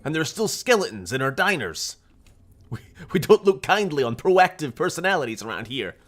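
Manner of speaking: high, loud henchman rant; hammed-up goon lecturing the hero; mock indignant thug. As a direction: rant like an outraged game baddie. Voices indignant wasteland thugs mockingly.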